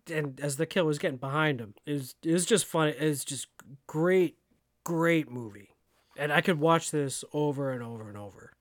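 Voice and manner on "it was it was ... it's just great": ordinary talk, nothing marked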